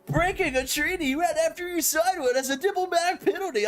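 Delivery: high, put-on stuffy advisor reading the penalty; mock the prim diplomat announcing the rule; game advisor voice. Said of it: "the guy comes in".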